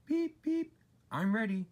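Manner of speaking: very high eager toots; cheerful little engine. A narrator's reading. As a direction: chirp it like a small engine. Edward whistling ready.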